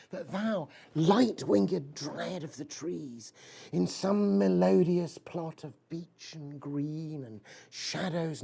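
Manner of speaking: slow, solemn poetry reading; poem recital. Acted. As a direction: let each line hang, very slowly. Recites Keats ode.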